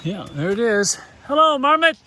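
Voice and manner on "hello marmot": calling out. Real, not acted. Greeting a marmot.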